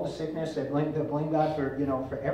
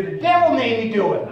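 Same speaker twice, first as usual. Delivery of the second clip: loud, raised comic voice; mock excuse, pitched up and punched out; Geraldine impression; 6 semitones higher, 7 dB louder than usual. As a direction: lightly imitate a high-pitched comic woman. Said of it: "with this high-pitched voice".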